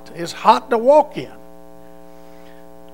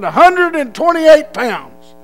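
shouted reply; 9 semitones higher, 9 dB louder, slower than usual. Retold or imitated. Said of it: "I said".

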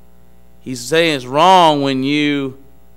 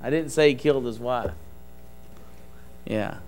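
usual speaking voice

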